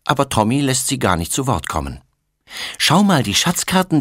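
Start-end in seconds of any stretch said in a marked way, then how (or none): 2.8-4.0 put-on eager little boy. A narrator's reading. Boy shows his maps.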